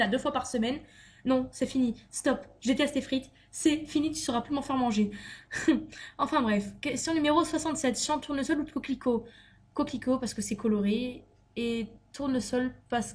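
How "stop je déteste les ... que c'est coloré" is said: normal talking voice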